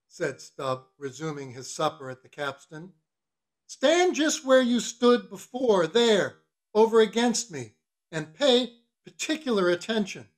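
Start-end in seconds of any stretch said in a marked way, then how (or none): 3.7-10.3 higher, deliberate mock-formal orders; bossy mate. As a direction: lay down orders to the cook. Stubb voiced, ordering the cook.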